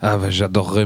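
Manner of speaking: usual speaking voice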